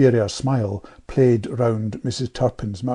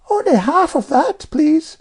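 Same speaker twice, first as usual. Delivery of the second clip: very high, louder child's imitation; Jane copying Amelia; 5 dB louder than usual. A narrator's reading. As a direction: mimic a polite girl, pitched way up. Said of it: "replied Jane by way of experiment".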